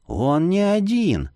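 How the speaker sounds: slow, hinting companion voice; a slow warning hint; Marius's voice. Acted. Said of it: "подсказал Марий".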